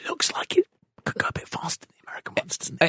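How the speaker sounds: hushed, conspiratorial whisper; whispered aside. Acted. Host joking about a Japanese motorbike, whispered aside. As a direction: whisper it like a sly remark on the side.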